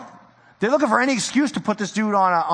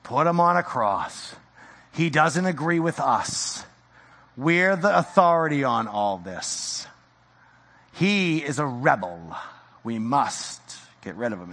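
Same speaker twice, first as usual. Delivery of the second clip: sinister plotting leaders; 6 semitones lower, 4 dB quieter, slower than usual. Retold or imitated. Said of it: "what is their response?".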